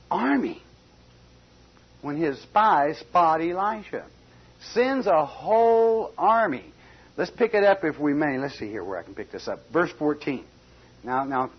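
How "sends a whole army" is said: slow, with whole stretched and leaned on; emphasis on whole. Acted. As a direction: dwell on whole to show the army's size. Preacher stresses the size of army.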